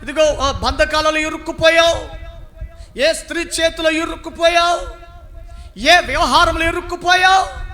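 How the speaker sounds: high, loud, shouted preaching, every line hammered; preaching at full force. Acted. Preacher shouting to a trapped listener, full-force preaching. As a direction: thunder each trouble at the congregation. Preacher builds a list of troubles.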